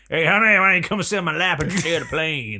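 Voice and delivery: high, loud sleazy charmer; sleazy pilot. Pretend. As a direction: chat up the stewardess, greasy.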